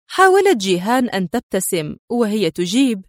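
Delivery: plain reading voice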